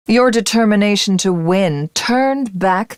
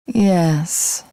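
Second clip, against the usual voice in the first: ordinary talk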